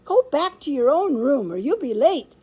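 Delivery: high, loud hamster warning; warning squeak. A narrator's reading. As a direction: warn him urgently in a little voice. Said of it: "I warned him".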